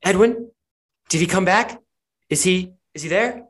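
urgent rising questions, called through; voice through a wall. Acted. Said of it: "you hear this voice really, really muffled".

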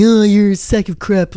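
hammed-up dismissive jeer; mock online heckler. Mimicking people on social media, mock heckling. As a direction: throw the insult like a mock troll.

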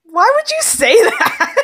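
high, loud indignant squeal; playfully outraged, laughing through it; mock offended. Real, not acted. Mock offence at a loss question.

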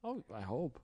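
ordinary delivery

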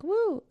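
high, loud "woo"; relieved whoop. Retold or imitated. Podcaster learning it was not monkeypox, acted relieved whoop.

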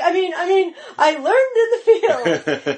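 high, stammering, laughing mock fright; flustered Rick. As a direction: stutter it out like a scared big guy. Quoting Rick, scared.

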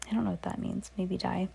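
quiet aside. Real, not acted. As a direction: drop the volume for a private aside. Reader's aside outside the story.

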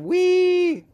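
high, loud squeal of glee; mock whee. Mocking a small-town guy with fireworks, a gleeful squeal. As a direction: squeal like a kid on a ride.

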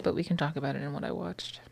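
hushed aside; whisper. Real, not acted. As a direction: whisper it under your breath. Aside about later topic.

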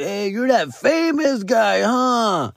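tipsy fan calling out slowly; drunk fan impression. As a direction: imitate a drunk guy hailing a celebrity. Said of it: "They were kinda drunk".

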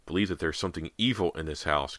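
ordinary delivery, nothing marked

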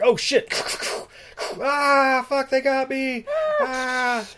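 high strained cry of defeat; hammed-up dying cop. Cops ambushed by vampires, mock dying cry. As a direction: overact getting caught.

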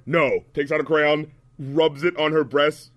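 ordinary talk

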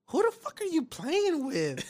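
high, slow mock wife voice; wife's outraged question; wife in the bedroom demanding who he plays with, high and slow; imitating wife. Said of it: "my wife's like".

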